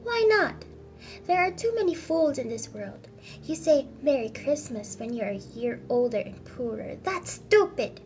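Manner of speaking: loud, exasperated outburst; angry rant. Acted. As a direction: rail at the foolishness, peaking at each end. Scrooge rants at his nephew.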